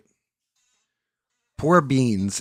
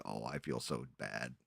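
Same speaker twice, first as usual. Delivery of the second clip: very soft, low, guilty aside; retelling his sheepish reaction, very softly; guilty murmur; 9 semitones lower, 16 dB quieter than usual. Retold or imitated. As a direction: mumble it under your breath, ashamed. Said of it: "I was like".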